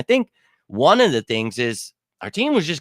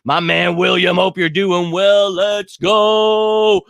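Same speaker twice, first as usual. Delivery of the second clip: hype shout-out; 6 semitones higher, 6 dB louder, much slower than usual. Real, not acted. Hyping a chatter.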